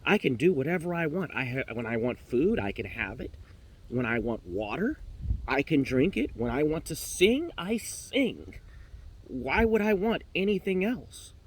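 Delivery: indignant, insistent protest; ruffled little bird building to indignation; mad canary. Acted. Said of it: "canary gets mad".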